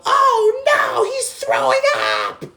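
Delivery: very loud, high drawn-out shriek; hammed-up horrified girl screaming; mock scream. Girl in cab seeing Jimmy vomit, mock scream.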